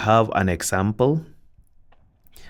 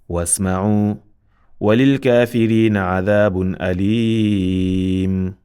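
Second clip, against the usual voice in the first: full, louder chanted verse; Quran recitation; 4 dB louder than usual. Acted. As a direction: recite it out with Tajweed.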